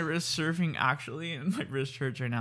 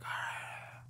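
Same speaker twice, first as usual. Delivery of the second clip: soft, voiceless weary sigh; tired co-host; 13 dB quieter than usual. Retold or imitated. Mimicking co-host closing his eye, exhausted breathy sigh. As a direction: let it out like you're dozing off. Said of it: "you close your eye, you're like".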